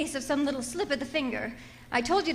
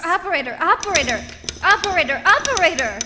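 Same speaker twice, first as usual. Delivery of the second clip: louder and louder repeated calls, each word falling; frantic calling; 3 semitones higher, 7 dB louder than usual. Acted. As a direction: keep calling into the phone, more desperate each time. Frantically calling dead phone line.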